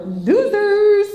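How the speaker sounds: high, loud, drawn-out "losers"; big mocking holler; mocking jeer. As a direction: stretch it out loud like a playground taunt. Mock emphasis on losers.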